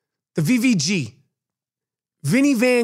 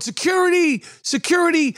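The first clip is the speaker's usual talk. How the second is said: onlookers crying out "Security!", much higher; mock panicked yell; 9 semitones higher than usual. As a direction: squeal it like a panicked museum visitor. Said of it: "people are going".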